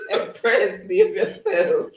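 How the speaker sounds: high, giggling mock scolding; laughing. Real, not acted. Streamer playfully scolds herself, high and laughing. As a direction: tell yourself off while cracking up.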